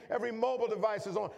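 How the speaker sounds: normal talking voice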